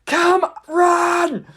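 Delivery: high, urgent shout urging a runner on; yelling. Acted. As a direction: yell desperately across a battlefield, hammed up. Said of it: "And Jerry was yelling".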